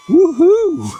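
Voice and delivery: high, loud joyful woohoo; excited woohoo. Real, not acted. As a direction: cheer in triumph. Celebrating success.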